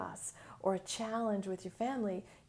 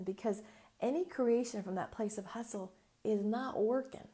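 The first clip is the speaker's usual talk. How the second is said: ordinary talk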